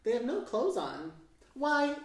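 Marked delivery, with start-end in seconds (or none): 1.5-2.0 high, loud astonished gasp of a word; a startled young elephant's cry. Acted. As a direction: blurt it out in sudden surprise. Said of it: "he says in astonishment".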